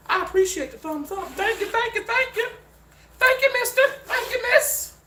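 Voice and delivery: squeaky cartoon voice thanking the viewers; squeaky thanks.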